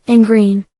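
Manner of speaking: usual speaking voice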